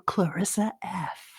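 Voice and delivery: slow breathy whisper of the name; whispered intro. Pretend. Host whispering the guest's name as a reveal, whispered. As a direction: hush it like a big reveal.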